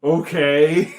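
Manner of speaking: loud, rising, dubious reaction; incredulous acceptance. Retold or imitated. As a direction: go along with something absurd. Reacts to absurd taxi scene.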